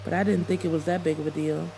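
ordinary delivery, nothing marked

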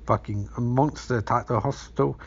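ordinary talk

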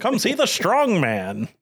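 high, slow carnival barker call; hammed-up barker drawing in the crowd.

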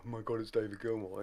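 a hint of a dazzled fan; starstruck fanboy. Recalling meeting his hero, hushed and awed. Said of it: "to stop going".

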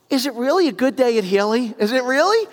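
high, loud mock-incredulous question; teasing challenge. Caller teasing the dealership receptionist, mock incredulous.

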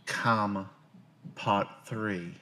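normal talking voice

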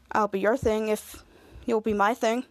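low, slow, sincere proposal; tender offer. Retold or imitated. Henry to Bianca on becoming a couple, tender offer.

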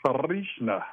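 Arishna, its R rolled out like a triple R. Shown as a demonstration. Teaching the clan leader's name, Arishna with rolled R. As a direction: trill the R in Arishna. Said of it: "have to roll the R".

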